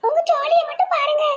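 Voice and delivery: high-pitched, restrained anger; angry. Acted. Young woman telling nosy vendor off, angry. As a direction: tell them off in a high voice. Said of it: "கோவப்படாத கண்ணம்மா".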